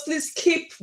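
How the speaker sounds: ordinary talk, nothing marked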